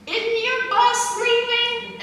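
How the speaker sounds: high, slow principal voice; a mother gently prodding a kid; mom's voice. Retold or imitated. Principal mom asks child about his bus, high and slow.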